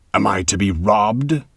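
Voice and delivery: loud, slow outraged demand; a gambler cheated of his due; indignant cry. A narrator's reading. Count protests to the dwarf, loud and outraged. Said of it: "cried Ildesheim".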